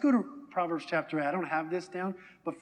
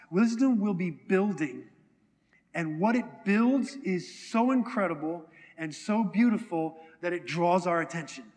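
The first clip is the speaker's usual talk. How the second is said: normal talking voice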